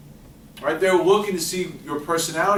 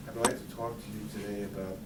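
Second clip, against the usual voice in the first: dull mumble; 8 semitones lower, 15 dB quieter than usual. Retold or imitated. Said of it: "spoke like that for an hour".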